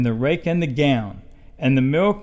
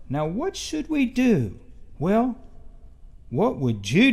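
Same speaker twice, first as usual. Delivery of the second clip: wondering question; 6 semitones higher than usual. A narrator's reading. Storyteller asks listeners.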